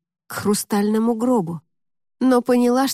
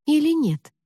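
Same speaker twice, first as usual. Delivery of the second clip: usual speaking voice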